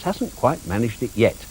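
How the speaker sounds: ordinary talk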